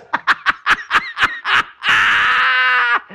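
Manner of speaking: high, exaggerated staccato laugh; hammed-up cackle ending in a long held cry; God's big laugh. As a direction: burst out in mock divine laughter. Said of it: "And God goes".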